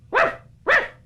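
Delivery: high yappy puppy barks, wouf wouf; dog barking. Pretend. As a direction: yap like a tiny dog for the shadow puppet. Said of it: "le petit chien". (aboiement aigu de petit chien)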